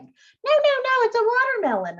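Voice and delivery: put-on high, fast, protesting child voice; a kid who won't be told otherwise; insistent kid.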